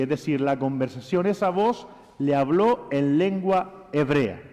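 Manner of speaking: ordinary talk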